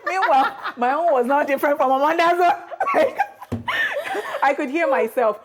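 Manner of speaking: laughing. Real, not acted. Laughing about her own imitation.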